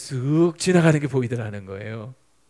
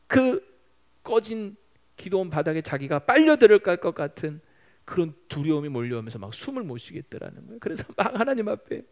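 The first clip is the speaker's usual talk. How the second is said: ordinary talk, nothing marked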